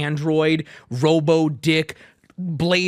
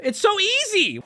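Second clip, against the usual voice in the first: very high-pitched insistence; exasperated exclamation. Real, not acted. Streamer telling the poster the answer is simple, exasperated exclamation. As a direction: cry it out as painfully obvious.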